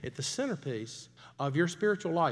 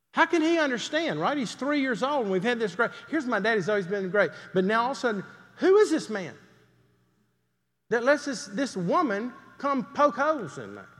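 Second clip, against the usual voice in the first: higher, louder rising appeal; impassioned plea; 7 semitones higher, 4 dB louder than usual. Acted. Preacher to congregation voicing his son's confusion, higher, louder and impassioned. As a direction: press the question with full force.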